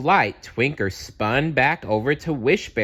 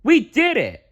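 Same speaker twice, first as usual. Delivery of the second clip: very high, loud cheer; triumphant; 6 dB louder than usual. A narrator's reading. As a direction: shout the win. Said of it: "said Champ Bear".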